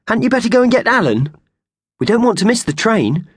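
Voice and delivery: high, bright squeaky urging; eager mouse voice. A narrator's reading. Purvis the mouse reminding Howard about the train. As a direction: nudge Howard like an impatient little mouse.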